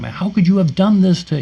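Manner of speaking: high-pitched, indignant mock yell; imitated angry protest; retold yelling. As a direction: act out yelling in outrage, without full volume. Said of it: "I'm yelling and screaming at him".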